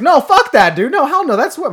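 high, loud mock protest; vehement denial. Teen friends answering his size question, loud indignant denial. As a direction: act out friends objecting hard.

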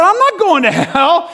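high, loud, slightly comic denial; re-enacted incredulous protest; indignant retort. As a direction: throw it back with a rising end. Teller retorts to the preacher.

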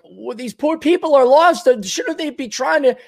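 high, fretful retelling; worried retold reaction. Childhood viewer worrying about Gilligan's castaways, high and fretful.

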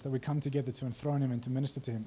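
ordinary delivery

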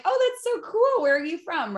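high-pitched gushing mock curiosity; gushing reaction. American reacting to a foreign accent, gushing. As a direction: imitate yourself cooing over an accent, pitched high. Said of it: "I hear your accent and I'm like".

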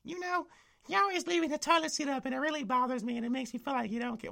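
put-on high, fast whiny girlfriend voice; mocking girlfriend. Girlfriend complaining in the kitchen section, mocking whine. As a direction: nag in a squeaky put-on voice. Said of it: "just randomly like".

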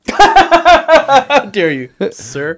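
high, loud, slow laughing indignation; mock outrage. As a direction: play it offended, drawn out through a laugh. Mock outrage at friend's insult.

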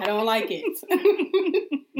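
slow, high laughing repeat. Real, not acted. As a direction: drag it out laughing.

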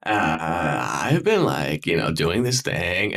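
mock lazy vocal fry, stretching the last syllable; imitating guys' vocal fry. Mocking how some guys talk, in a dragged-out creaky fry. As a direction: draw the words out low and creaky, dragging the ends. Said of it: "talk like this".

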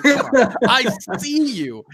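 very high, slow, laughing imitation of dad; mock dad calling. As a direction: tease him out to the car.

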